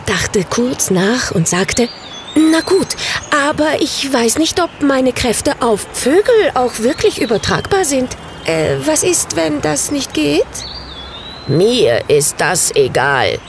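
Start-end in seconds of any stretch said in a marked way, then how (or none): 2.3-10.4 put-on higher, hesitant girlish voice; worried little witch. A narrator's reading. Samantha doubts her magic works on birds, high and hesitant. 11.5-13.4 slow, low dismissive bird; indifferent bird. A narrator's reading. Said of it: "sagte der Vogel".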